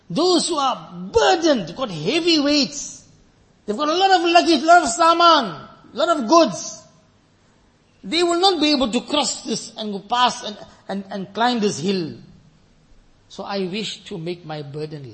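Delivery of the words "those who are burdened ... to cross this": full-force preaching. Acted. Preacher warning the brothers of the trumpet, loud preaching. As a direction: thunder it out to the congregation.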